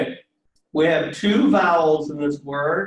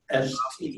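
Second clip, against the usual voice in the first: S T with the accent on T; stress on second syllable; 2 semitones lower, 6 dB quieter than usual. Shown as a demonstration. Teacher points out the accent, S T stressed on T. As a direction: put the weight on T in S T. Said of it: "accents on the second syllable".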